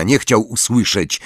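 ordinary delivery, nothing marked

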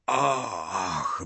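long sigh like a moan; groaning sigh. A narrator's reading. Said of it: "westchnienie Dumbledora zabrzmiało jak jęk".

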